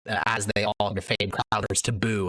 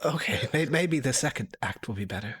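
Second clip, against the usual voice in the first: hopeful scholar; 5 semitones higher than usual. Acted. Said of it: "Democritus replies".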